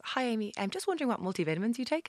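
high, light voice of a polite fan; imitated fan message. Guest voicing a common DM from followers, high mock-polite.

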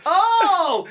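loud, high-pitched "oh" of recognition; exaggerated retold outburst; acted sudden realisation. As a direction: play up the penny dropping. Acts out his sudden realisation.

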